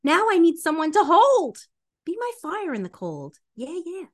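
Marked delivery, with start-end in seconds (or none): none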